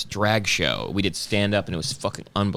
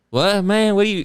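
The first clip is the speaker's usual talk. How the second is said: stoner friend; 10 semitones higher, 4 dB louder than usual. Retold or imitated. Drew smoking weed, mock stoner voice. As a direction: play the stoned buddy. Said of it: "Drew's like".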